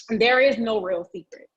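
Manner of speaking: usual speaking voice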